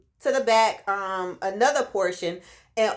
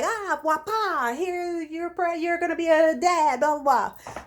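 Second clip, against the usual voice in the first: high sing-song pretend announcement, trailing into blah-blah; lilting, high-pitched make-believe reveal; mock surprise announcement; 7 semitones higher than usual. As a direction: playfully act out a cute, sweet surprise. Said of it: "surprise him, like".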